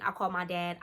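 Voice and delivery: ordinary delivery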